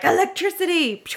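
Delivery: high mock god cry; playful thunder god shout; Thor voice. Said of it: "Thor coming down with his hammer and be like".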